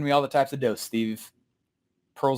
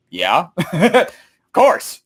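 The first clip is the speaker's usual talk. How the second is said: amused agreement; 5 dB louder, much slower than usual. Retold or imitated. Imagined audience answers the speaker, amused agreement. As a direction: laugh through an obvious yes. Acting out eager audience reply.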